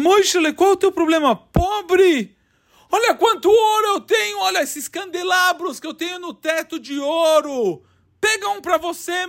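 a talking idol calling to the crying man; statue voice. Acted. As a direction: call him in as a sly idol. Statue offers the gold.

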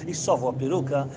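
normal talking voice

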